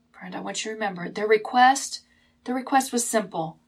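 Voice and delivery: normal talking voice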